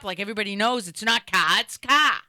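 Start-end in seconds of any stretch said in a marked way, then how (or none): 0.9-1.6 Boston CAR with the R dropped; Boston accent. Shown as a demonstration. Stand-up bit mocking film Boston accents, milder Boston CAR. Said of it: "these horrific Boston accents". 1.6-2.1 CAR louder and higher, Boston R-less vowel blown up; over-the-top Boston accent. Shown as a demonstration. Stand-up bit correcting film accents, over-the-top Boston CAR. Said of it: "Like, it's over the top".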